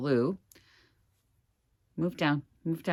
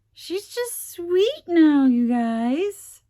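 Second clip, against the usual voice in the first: high, loud, drawn-out squeal of adoration; over-the-top adoring squeal; gushing coo; 9 dB louder, much slower than usual. Real, not acted. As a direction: coo over it, stretching the words out. Cooing over the doll she made.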